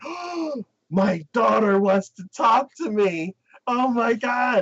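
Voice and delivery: high, slow, giddy dad voice; thrilled dad. Retold or imitated. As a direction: play the overjoyed father.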